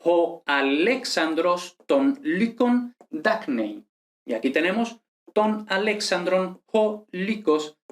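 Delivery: slow, careful Ancient Greek reading, "ho Alexandros ton lykon dacnei" word by word; reading Ancient Greek aloud. Shown as a demonstration. Teacher reading out a Greek example sentence for students, slow and careful. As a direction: read the Greek sentence slowly and precisely, syllable by syllable. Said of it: "deberías ser capaz de leer este texto".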